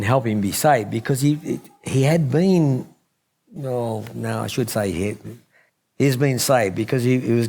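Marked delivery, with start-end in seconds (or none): none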